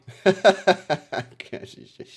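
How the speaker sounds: loud laugh at himself; cringing burst of laughter; embarrassed laugh. Real, not acted. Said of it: "It's not good".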